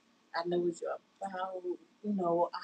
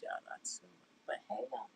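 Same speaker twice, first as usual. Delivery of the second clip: whisper; 9 semitones lower, 10 dB quieter than usual. Real, not acted. Whispered aside.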